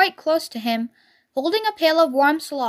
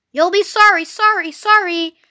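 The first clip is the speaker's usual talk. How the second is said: high, loud warning call; 4 semitones higher, 5 dB louder than usual. A narrator's reading. Goose warning Wilbur not to follow, called warning. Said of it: "called the goose".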